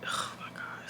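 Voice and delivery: hushed dramatic whisper. Real, not acted. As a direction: whisper it like gossip about to drop. Dramatic reveal in the story.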